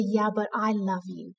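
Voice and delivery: a hushed, loving answer; gentle reassurance. Retold or imitated. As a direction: soothe her quietly as God would. God answers her tenderly.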